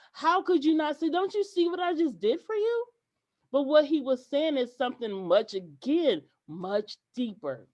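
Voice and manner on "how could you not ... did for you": high, louder exasperated question; replaying the anger, pitched up; mad retort. Demanding the client see her work, mad and high. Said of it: "I was mad because I'm like".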